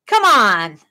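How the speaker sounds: ordinary talk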